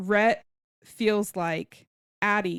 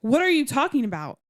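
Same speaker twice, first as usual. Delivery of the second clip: high, louder retold demand; mock indignation; 6 semitones higher, 4 dB louder than usual. Addie confronting them over what she overheard, mock indignant. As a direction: act out an affronted challenge.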